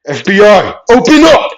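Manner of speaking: loud, high shout of a raid; yell. Acted. Character storming in on hidden opponents, yelled. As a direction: burst in shouting at full voice. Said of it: "he would yell".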